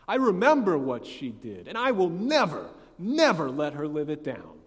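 loud, hammed-up vindictive vow; bitter grudge-holder. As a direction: act out someone who will never forgive. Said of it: "like to hold a grudge".

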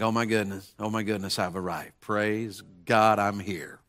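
mock the pious show-off, hushed and pleased; a lightly voiced self-righteous believer; smug self-satisfaction. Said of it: "We can think".

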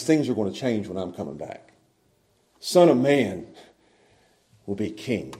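low, very soft quoted promise; hushed solemn quote. Retold or imitated. Preacher quoting Jesus' promise of return, hushed and solemn. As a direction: drop right down, weighty and unhurried.

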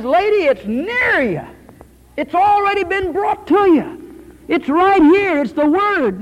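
building from earnest to loud, high exhortation; full-force preaching. Acted.

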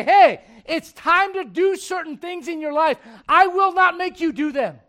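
loud, emphatic preacher building to a climax; preaching at full force. Acted. Preacher urging young men to talk face to face, loud and fervent.

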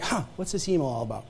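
soft, fast puzzled mutter; low puzzled aside; quiet musing. Retold or imitated. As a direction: muse to yourself quietly. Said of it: "And I'm like".

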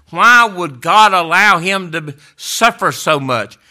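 a sudden burst of preaching force; preaching cry. Real, not acted. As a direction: throw the question out to the congregation. Preacher's rising rhetorical cry.

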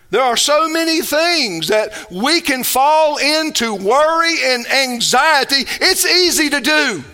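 deliberate, weighty pulpit cadence; emphatic preaching. Real, not acted. Pastor urging believers to seek God's kingdom first, emphatic preaching. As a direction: press each phrase home to the congregation.

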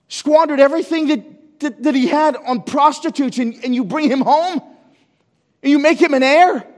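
high, loud indignant tirade; outraged protest. Acted. Elder son raging at his father, high and loud.

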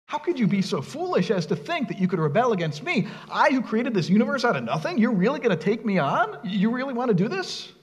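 lightly voiced scoffing challenge; mildly mocking God taunting the rebels. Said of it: "God is sitting in heaven scoffing at them".

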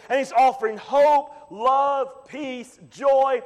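high, forceful sermon climax; impassioned preaching. Real, not acted. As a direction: preach it with rising fervour, punching the key words.

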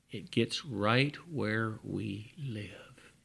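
ordinary talk, nothing marked

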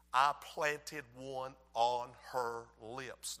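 very slow, low, softened delivery; drawn-out romantic punchline; savoring. Real, not acted. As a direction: draw it out word by word, teasingly. Savouring the kiss, teasing congregation.

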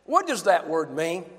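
normal talking voice

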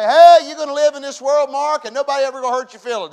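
high, loud shouted promise; mock promise. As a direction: belt out a rosy promise to the crowd. Preacher mocks a false promise.

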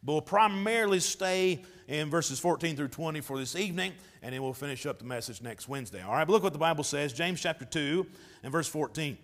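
ordinary talk, nothing marked

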